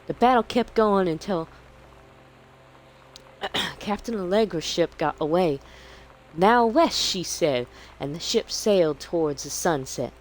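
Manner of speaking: high Southern Belle narration; Southern Belle accent. Acted. Ending a child's pirate adventure, in a Southern Belle accent. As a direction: close the story as a Southern Belle. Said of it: "Not very good at the Southern Belle".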